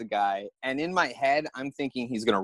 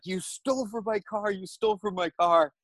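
retold yell; 7 semitones higher than usual. Victim yells at car thief, retold yell. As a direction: imitate yelling an accusation, voice raised in pitch. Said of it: "I'm yelling at him".

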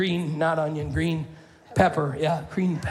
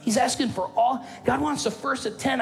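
mock complainer; 8 semitones higher than usual. Mocking a greedy-God complainer.

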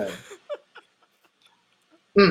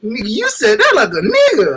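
higher, louder, laughing disbelief; amused disbelief; 6 semitones higher, 5 dB louder than usual. Retold or imitated. Reacting to Pearce's outburst, high laughing disbelief. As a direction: marvel at it half-crying. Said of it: "I was like".

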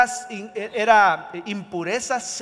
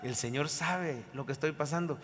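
quiet reminder; 5 semitones lower, 12 dB quieter than usual. Shown as a demonstration. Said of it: "haga una pausa".

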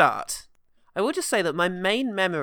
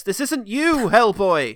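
high, swelling dramatic plea; pleading Ian Lovejoy; 6 semitones higher, 7 dB louder, slower than usual. Acted. Film character confronting Hellboy as he goes bad, melodramatic plea. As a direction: plead loudly, rising on the name. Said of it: "Ian Lovejoy shows up and says".

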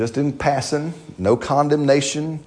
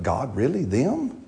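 hushed, hesitant questioning of God, acted; soft, drawn-out reluctant doubt; doubtful; 7 dB quieter, slower than usual. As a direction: sigh and voice mock reluctance softly. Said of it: "you'd be like".

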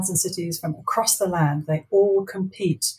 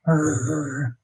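dog growl; 4 semitones lower than usual. Acted. They growled.

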